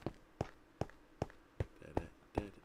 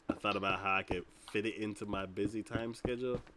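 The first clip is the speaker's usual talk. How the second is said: ordinary talk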